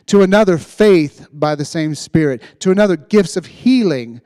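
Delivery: loud, high, fervent preaching; preaching at full force. Acted. Pastor urging the congregation to act in faith, loud fervent preaching. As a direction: preach it out with everything you've got.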